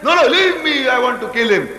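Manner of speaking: high protest, easing down into the threat; mock struggle to break free and attack; acting held back. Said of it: "As if somebody is holding to him".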